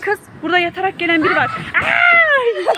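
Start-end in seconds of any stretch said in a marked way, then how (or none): 1.8-2.8 high yelp melting into laughter; surprised shriek turning into giggles; startled yelp. Real, not acted. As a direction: jump with fright then laugh. Startled by child sliding down.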